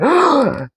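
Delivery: sharp imitated gasp, high and breathy; a hammed-up gasp of awe; mock gasp. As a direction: gasp like he saw something shocking. Friend ogling waitresses again.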